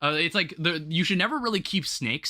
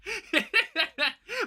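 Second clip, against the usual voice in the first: high laugh; 13 semitones higher than usual. Real, not acted. Laughs at the snake story.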